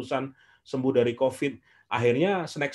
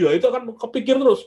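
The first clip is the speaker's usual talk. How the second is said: suddenly much higher and louder, eager; excited exclaim; 10 semitones higher, 6 dB louder than usual. Real, not acted. Preacher telling his audience about affirmations, excited. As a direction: throw the point out with a burst of excitement.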